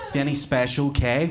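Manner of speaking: ordinary delivery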